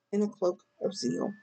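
usual speaking voice

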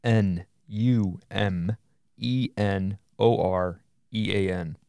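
N U M E N O R E A N spelled letter by letter, slowly; spelling it out. Shown as a demonstration. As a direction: spell out N U M E N O R E A N one letter at a time. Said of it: "so I'm gonna spell it for you".

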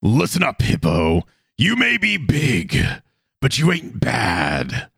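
slow, drawn-out pro wrestling growl; Macho Man Randy Savage impression. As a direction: ham it up like a wrestling promo. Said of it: "my best Macho Man Randy Savage Boulder impression".

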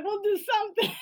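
high-pitched, through laughter; laughing offer. Pretend. Host jokingly offering payment for free editing, laughing and high. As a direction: make a mock plea while laughing.

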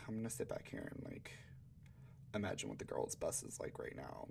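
soft, retreating inner monologue; softly voiced awkward thought; retold withdrawn aside. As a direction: say it softly, keeping to yourself. Retold withdrawn thought among boys.

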